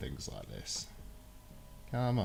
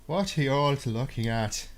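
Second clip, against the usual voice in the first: lilting stage-Irish brogue; mock Irish accent; 4 semitones higher than usual. Shown as a demonstration. Streamer showing viewers his Irish impersonation, hammed-up Irish accent. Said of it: "pretty interesting impersonations of the Irish".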